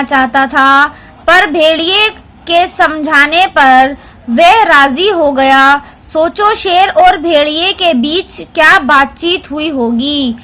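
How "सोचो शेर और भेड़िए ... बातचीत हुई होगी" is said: ordinary talk, nothing marked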